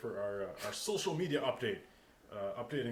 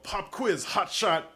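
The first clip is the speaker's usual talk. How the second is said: slow, loud, higher announcer call; 9 semitones higher, 10 dB louder, much slower than usual. Acted. Host announcing a surprise segment to co-hosts, in a slow, loud game-show voice.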